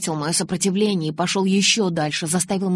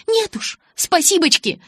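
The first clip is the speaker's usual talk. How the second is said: high, sarcastic, angry retort; hissed refusal; 11 semitones higher, 5 dB louder than usual. A narrator's reading. Bella turning down Jacob's ride, angry hiss. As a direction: spit out the thanks, pitched high. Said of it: "прошипела я".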